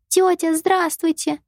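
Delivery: put-on high, slow polite child greeting; a small girl saying hello sweetly; little girl voice. A narrator's reading.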